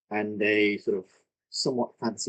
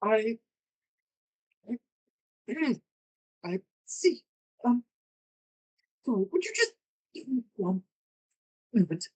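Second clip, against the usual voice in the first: much higher, hesitant elf voice; nervous elf; 13 semitones higher than usual. Acted. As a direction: ask for a moment, flustered and high.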